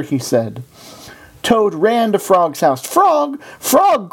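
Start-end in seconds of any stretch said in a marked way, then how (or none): 2.8-4.1 high-pitched eager call; excited cry. A narrator's reading. Toad runs to his friend's house, crying out excitedly. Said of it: "cried Toad".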